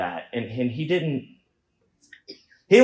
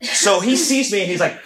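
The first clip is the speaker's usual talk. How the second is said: ordinary delivery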